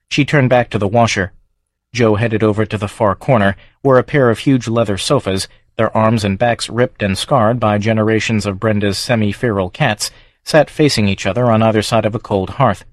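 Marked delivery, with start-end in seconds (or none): none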